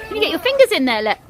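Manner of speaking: ordinary delivery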